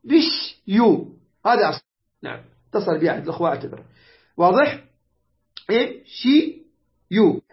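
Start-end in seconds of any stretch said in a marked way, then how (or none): 0.0-1.1 مش يو slow, starting without the initial vowel; hamza dropped. Shown as a demonstration. Teacher showing the form without hamza, slowly syllabified. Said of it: "يعني اترك الهمزة". 5.7-7.4 امشيوا very slow with gaps, im - shi - yu; syllable by syllable. Shown as a demonstration. Teacher checking students understand, slowly syllabified. Teacher sounds out the original form.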